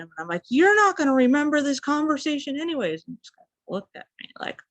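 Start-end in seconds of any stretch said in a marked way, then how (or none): 0.5-3.0 high, loud retort; exasperated high yell at a confused man; yelled back. Retold or imitated. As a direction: shout it back at him. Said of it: "I just yelled back at him".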